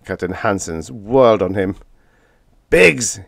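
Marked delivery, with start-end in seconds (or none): 2.7-3.2 sharp, louder rebuke of a name; a stern captain's bark; stern snap. A narrator's reading. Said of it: "he snapped sternly".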